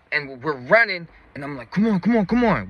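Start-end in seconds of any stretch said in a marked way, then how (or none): 1.7-2.7 high, repeated hurry-up; retold urging. Thief running for the car with partner, high urgent retold urging. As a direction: push your partner to keep running.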